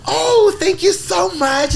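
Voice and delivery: very high, gushing imitation; super happy customer. Happy customer thanking a store worker, gushing. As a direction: squeal it slowly in overjoyed mock gratitude. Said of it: "super happy".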